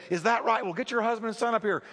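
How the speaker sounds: mock amazed preacher; surprised. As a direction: sound amazed and eager. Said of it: "as surprised as anybody".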